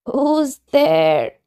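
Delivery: granny calling from her bed; old grandmother. Acted. Grandmother in bed asking who knocks, lightly voiced old lady.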